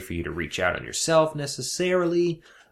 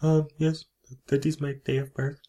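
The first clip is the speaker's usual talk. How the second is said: higher, halting put-on foreign voice; foreign DMV customer; 7 semitones higher than usual. Customer confirming details to a DMV clerk, hesitant foreign voice. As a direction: play a hesitant foreigner at the counter.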